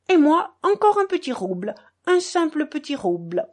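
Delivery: high-voiced, delighted little gambler; blissful. A narrator's reading. Maximov, delighted to have won, bets again blissfully. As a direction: pipe up high, thrilled with a small win. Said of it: "murmura béatement Maximov, enchanté d'avoir gagné".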